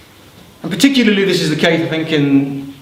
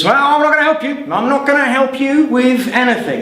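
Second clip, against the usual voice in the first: higher, haughty demon refusing; a stubborn little demon, higher voice; defiant demon; 9 semitones higher than usual. Acted. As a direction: sneer the refusal from on high.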